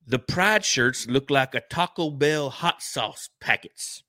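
slow, drawn-out mock headline read; a slow, hammed-up headline voice; mock announcer read. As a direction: announce it like a news teaser.

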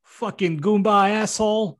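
high-pitched Jersey tough guy; hammed-up Jersey wiseguy; Jersey accent. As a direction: curse him out in a Jersey accent. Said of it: "I don't know why he has a Jersey accent".

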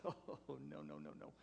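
hushed, breathy no, no, no; laughing it off under his breath; amused friend. Retold or imitated. Friend from Colorado hushing a mistaken runner, softly. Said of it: "and he goes".

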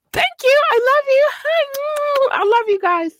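very high, cutesy squeal of thanks; squeaky falsetto. Pretend. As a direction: gush it out in a tiny high voice.

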